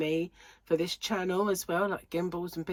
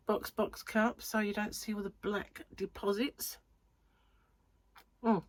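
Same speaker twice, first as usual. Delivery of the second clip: ordinary delivery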